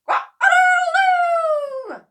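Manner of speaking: very high, loud, slow crowing; the cockerel squawking as it shoots up, high and loud. A narrator's reading. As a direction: crow it out like a rooster. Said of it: "squawked the cockerel".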